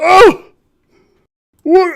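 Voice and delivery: loud high "Oh! What?"; shocked cry. Acted. Shocked high cry. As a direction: Harvick freaking out over the body. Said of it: "he's like".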